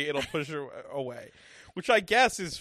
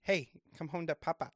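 put-on Midwest accent; 6 dB quieter, faster than usual. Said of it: "I put my Midwest accent on".

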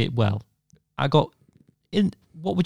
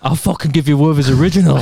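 louder, gangster nan laughing; laughing granny threat; 3 semitones higher, 6 dB louder than usual. Retold or imitated.

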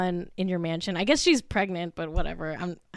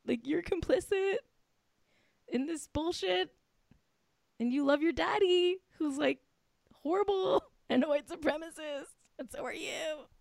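mock telling off; 6 semitones higher, 7 dB quieter than usual. Mock confronting Ivanka, soft high.

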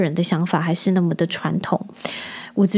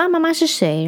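higher, lightly put-on old man asking; imitating a nosy old man; 9 semitones higher than usual. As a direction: mimic the stranger's prying question. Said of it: "然后他就看到我说". (模仿路过阿伯好奇地问)

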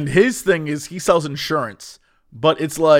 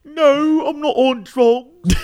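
high, loud, slow British protest; British Stevie voice; 9 semitones higher, 4 dB louder, slower than usual. Retold or imitated. Stevie answering whether he's on drugs, slow exaggerated British. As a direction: deny it in hammed-up British. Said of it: "Are you on drugs too, Stevie?".

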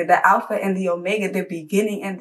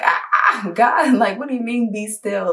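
laughing disbelief; 2 semitones higher than usual. Retold or imitated. Laughing disbelief at God's answer.

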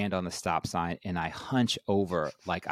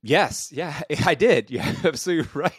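real laughter through the words; laughing along, a bit faster; 8 semitones higher, 6 dB louder than usual. Real, not acted. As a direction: laugh while agreeing, higher and louder. Laughing at the host's joke.

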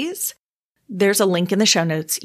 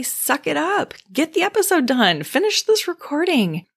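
higher, pushy self-scolding; harsh self-talk; 9 semitones higher than usual. Retold or imitated. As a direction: scold yourself to push on. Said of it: "What I want to do is say".

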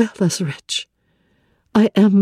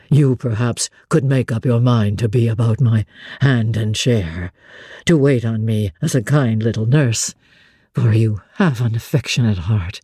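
lower, bitter and doubting; brood, then press her gently; a lower Rochester testing Jane's feelings; gloomy Rochester; 10 semitones lower than usual. Acted. Narrator voices Rochester lower.

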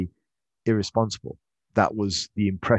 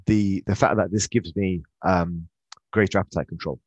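normal talking voice

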